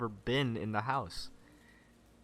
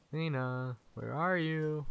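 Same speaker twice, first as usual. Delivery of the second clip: higher-pitched searching call; 6 semitones higher, slower than usual. Acted. Searching for Lena, calling out. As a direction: call out for someone missing.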